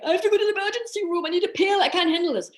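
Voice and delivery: high, fast, panicky rush of words; mock panic. A patient in sudden pain imagines rushing to hospital, in high fast panic. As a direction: blurt it out like someone rushing to the emergency room. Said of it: "would have sent you like".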